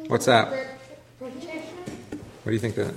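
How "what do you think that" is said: coaxing question. Real, not acted. Teacher prompting silent class.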